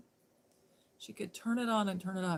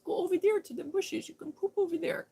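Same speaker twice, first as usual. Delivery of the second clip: high scolding coo; 10 semitones higher than usual. Retold or imitated. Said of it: "and I say".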